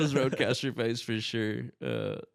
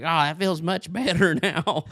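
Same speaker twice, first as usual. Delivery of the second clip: high, loud, slow boyish imitation; drawn-out relief in a cartoon kid voice; Turkey Feather; 7 semitones higher, 5 dB louder than usual. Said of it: "looking and sounding like Bobby Hill".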